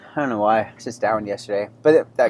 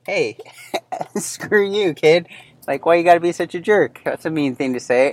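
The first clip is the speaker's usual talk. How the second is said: higher, half-laughing mock outrage; mock indignant retort; 6 semitones higher than usual. Father retelling his reply to his son, mock indignant. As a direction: snap back at the kid, half joking. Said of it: "And it's like".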